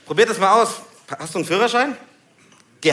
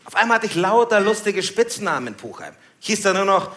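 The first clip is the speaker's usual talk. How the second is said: ordinary talk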